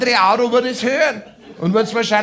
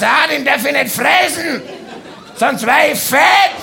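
higher, louder laughing outburst; laughing shout; 7 semitones higher, 4 dB louder than usual. Acted. Comedian voices himself at the fridge, laughing shout. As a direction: yell it through laughter.

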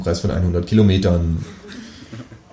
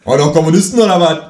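louder, higher fast aggressive jeer; thug shout; 8 semitones higher, 6 dB louder, faster than usual. Retold or imitated. Tattooed thug jeering at the kangaroo's communist talk. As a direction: bark it at him like a thug.

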